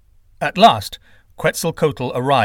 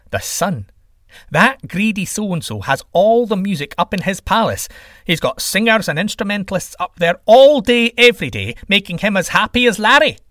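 high, loud indignant rant; worked-up, emphatic grumbling; resentful sky god; 8 semitones higher, 6 dB louder than usual. Acted. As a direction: complain about a greedy rival. Sky god complains about sun.

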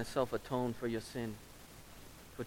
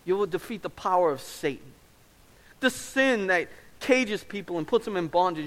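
louder and higher, building to a near shout; rising preacher's cry; 6 semitones higher, 8 dB louder than usual. Acted. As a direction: proclaim victory with full force. Father's promise, preached at full force.

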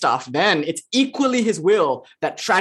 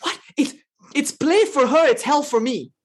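higher, mock outraged protest; indignant protest; 8 semitones higher than usual. Devotee protesting to Ramakrishna, mock outraged. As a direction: play the angry devotee, pitched up. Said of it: "then he said".

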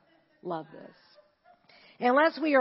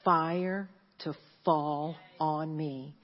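quiet prayer; 7 semitones lower, 6 dB quieter, slower than usual. Retold or imitated. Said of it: "and then I did this".

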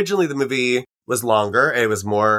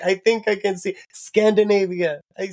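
squinting at screen; 10 semitones higher than usual. Retold or imitated. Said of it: "and I was like".

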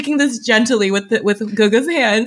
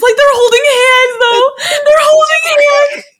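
high fangirl squeal, close to crying; giddy screaming; 5 dB louder than usual. Real, not acted. Host screaming to her co-host about the hand-holding. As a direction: squeal it louder and louder in disbelief. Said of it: "when we started screaming about".

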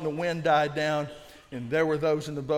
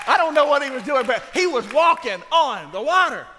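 much louder and higher, bursting out; excited shout; 8 semitones higher, 9 dB louder than usual. Real, not acted. Preacher to his church, an excited shout. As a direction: blurt it out laughing at the image.

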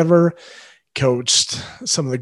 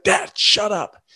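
higher, drawn-out imitated teen irritation; rude, annoyed; 6 semitones higher, slower than usual. Son on the phone to his interrupting dad, rude and annoyed. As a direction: mimic a kid rudely brushing off a parent. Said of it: "And just very rude".

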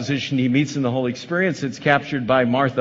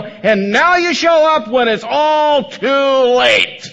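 much higher and louder, hurt and accusing; frustrated outburst; 9 semitones higher, 7 dB louder than usual. Acted. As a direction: shout it out like a betrayed sister, slowing at the end. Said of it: "what she was really saying under her breath".

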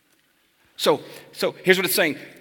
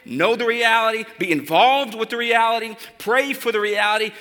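rallying charge; 4 semitones higher, 3 dB louder than usual. Acted. Rising exhortation close.

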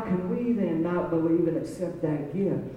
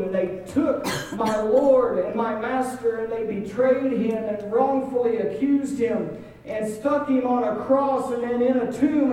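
raised, insistent sermon delivery; impassioned preaching; 5 semitones higher, 4 dB louder than usual. Acted. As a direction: build the story with mounting urgency. Preaching at rising force.

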